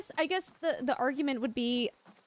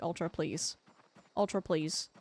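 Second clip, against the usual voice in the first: cringing plea; 7 semitones lower, 3 dB quieter than usual. Retold or imitated. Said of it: "they're just cringing so hard".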